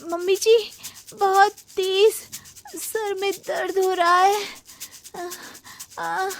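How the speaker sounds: milk the fake pain for sympathy; faked headache. Continues faking a headache. (सास से झूठे सिर दर्द की शिकायत, नाटकीय कराह)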